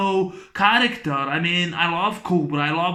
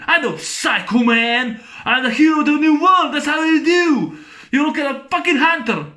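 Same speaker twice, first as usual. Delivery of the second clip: high, loud manic villain shouting; crazed killer rant; 7 semitones higher, 4 dB louder than usual. Retold or imitated. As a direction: rant like an unhinged killer, fast. Quotes the Night Slasher ranting.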